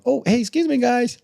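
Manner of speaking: squeaky fake-sweet politeness to tough guys; Mister Nice Guy. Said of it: "Mister Nice Guy".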